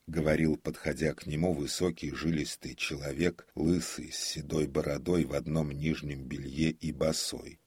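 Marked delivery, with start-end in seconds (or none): none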